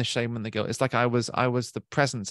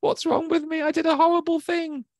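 put-on much higher, small child's panicked voice; scared seven-year-old kid. Grown man voicing his panicked inner child, much higher. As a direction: become a frightened little kid who thinks he did wrong. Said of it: "like, a seven-year-old kid going".

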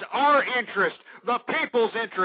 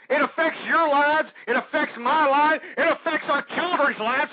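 impassioned; 6 semitones higher than usual. Real, not acted. Radio host's rising rant.

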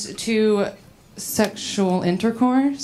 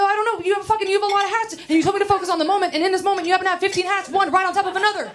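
high, rapid babble; flustered woman defending her hat question; flustered ranting; 10 semitones higher, much faster than usual. Retold or imitated. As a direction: rattle it off high and fast. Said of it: "I was like".

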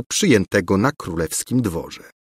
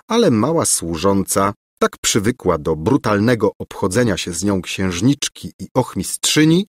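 ordinary delivery, nothing marked

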